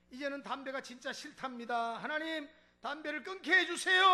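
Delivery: climbing from firm to a loud, high cry; a pastor's prayer swelling into a shout; building fervent prayer. Retold or imitated. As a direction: pray harder and harder until the final plea rings out. Pastor's forceful prayer over deacon.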